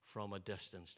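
deep, slow, hushed imitation of Sidney Poitier; solemn whispery elder's voice; Sidney Poitier impression. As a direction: drop the voice low and slow like a grand old actor. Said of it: "I've been practicing that all week".

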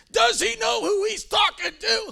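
high, loud indignant yell of a wounded ego; mock furious shout. Preacher acting out his past anger, a high indignant shout. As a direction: shout it, outraged at the pastor. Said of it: "I would be so mad".

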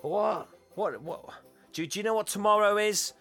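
put-on high-pitched, incredulous girlfriend; mock indignation. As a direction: act affronted and demand an answer. Acting out indignant girlfriend questioning him.

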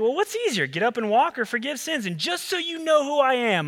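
high, pointed rhetorical question; Jesus challenging. Retold or imitated. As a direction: toss the question back at them. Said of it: "And Jesus is like".

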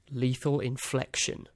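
ordinary delivery

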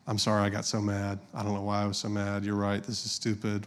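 soft, low, sheepish admission; subdued apology. Retold or imitated. Wife apologizing to husband after getting mad, subdued. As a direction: back down quietly.

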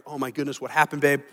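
soft, low, tender concern; concerned husband. Acted. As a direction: gently ask an upset partner what happened. Husband consoles crying wife.

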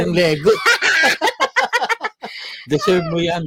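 laughing through a teasing jab. Real, not acted. Co-host teasing the Lego-stepping dad, laughing. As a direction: say it while still laughing, mock scolding.

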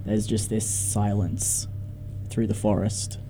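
slow, soft ominous narration; eerie scene setting. A narrator's reading. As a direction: let the silence hang, slow and hushed.

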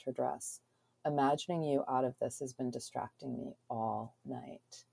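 soft, unhurried reading of a romance scene; story narration. A narrator's reading. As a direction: read it like an audiobook narrator. Reads novel excerpt aloud.

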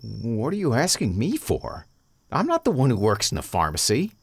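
exasperated retort. A narrator's reading. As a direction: fire it back, annoyed. Wyatt snaps back at Maddox.

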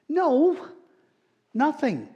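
high, loud no, then nothing; emphatic no. Real, not acted. Pastor rejecting fear of damnation, high loud emphatic no. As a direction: shout down the idea.

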